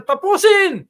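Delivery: very high, loud slogan cry; imitated rally shout. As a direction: mimic a leader yelling to the crowd. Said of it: "Sisigaw siya ng". (ginagayang matinis at malakas na sigaw)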